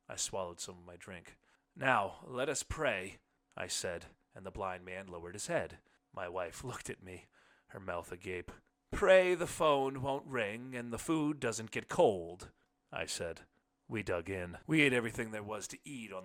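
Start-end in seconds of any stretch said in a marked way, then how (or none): 1.7-3.2 mock solemn. A narrator's reading. Narrator's mock grace. 8.9-12.5 slow, louder, mock-solemn grace; husband's joking prayer before dinner. A narrator's reading.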